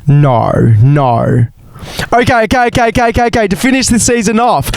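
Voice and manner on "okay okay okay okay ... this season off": high, rushed okays; pumping yourself up high; high excited build-up. Real, not acted. Hyping herself up.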